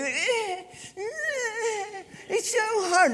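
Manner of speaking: high mock-crying whimper; whiny crying kid. Kid whining mid-workout, high mock crying. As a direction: whine it like a boy giving up. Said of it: "and the kid would".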